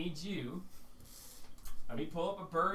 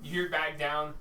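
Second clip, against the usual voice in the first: ordinary talk, nothing marked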